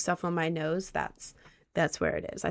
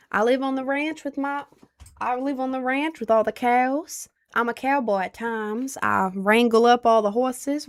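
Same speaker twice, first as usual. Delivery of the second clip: put-on Southern cowboy accent. Cowboy introduces wife and horses to chat, Southern twang. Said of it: "I can do a Southern accent".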